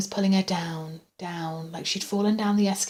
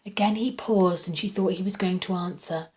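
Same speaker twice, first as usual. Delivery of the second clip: ordinary delivery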